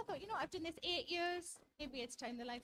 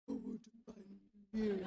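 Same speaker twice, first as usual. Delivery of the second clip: lower, tender murmur to the cat; 6 semitones lower than usual. Retold or imitated. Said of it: "he goes".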